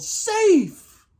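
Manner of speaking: very high, ringing call; umpire's safe call. A narrator's reading. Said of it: "the umpire called".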